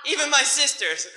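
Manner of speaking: normal talking voice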